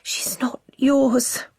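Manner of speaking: slow, breathy frightened whisper. A narrator's reading. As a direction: whisper it in terror. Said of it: "Coco whispers".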